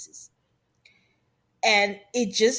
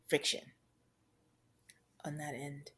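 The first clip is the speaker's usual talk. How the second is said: whispered trailing off; 7 semitones lower, 14 dB quieter than usual. Real, not acted.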